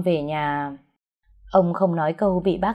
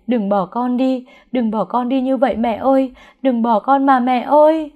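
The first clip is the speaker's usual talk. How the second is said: higher, louder crying pleas; sobbing plea; 4 semitones higher, 4 dB louder than usual. Acted. Daughter begging her dead mother to wake, sobbing. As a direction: beg her mother through tears. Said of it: "tiếng khóc của tôi cũng đau đớn".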